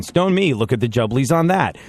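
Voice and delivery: stone me in a plain American accent. Shown as a demonstration. Host shows how the English phrase fails in American, American accent. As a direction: say it all-American, sounding out of place.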